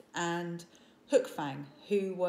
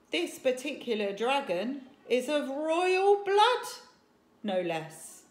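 carried away; 6 semitones higher, 8 dB louder than usual. A narrator's reading. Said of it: "getting a bit carried away".

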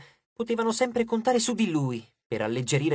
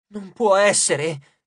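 slow, louder mutter; muttering disbelief; 4 semitones higher, 5 dB louder, much slower than usual. A narrator's reading. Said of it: "borbottò".

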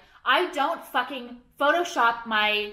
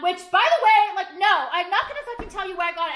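higher and louder, laughing in annoyance; loud exasperated rant; 7 semitones higher, 5 dB louder than usual. Real, not acted. Telling viewers she won't reveal the hat, loud fed-up laugh. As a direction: blurt it out, fed up but laughing.

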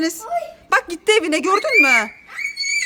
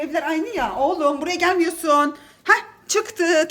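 ordinary talk, nothing marked

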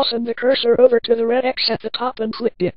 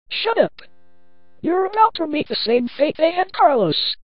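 high-pitched, angry villain threat; high and menacing; 8 semitones higher than usual. A narrator's reading. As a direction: threaten your victim in a raised, high voice. Possessed character threatens her victim.

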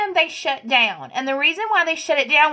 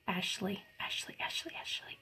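whispered, much softer, name repeated; 6 semitones lower, 21 dB quieter than usual. Real, not acted. Whispering a name while trying to place her.

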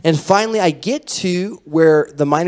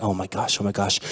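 stunned under breath; 9 semitones lower, 8 dB quieter than usual. Retold or imitated. Stunned after ribs crack.

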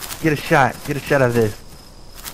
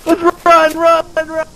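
high frightened urging; panicked; 14 semitones higher than usual. Pretend. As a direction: urge everyone to flee in fear. Fleeing zombies.

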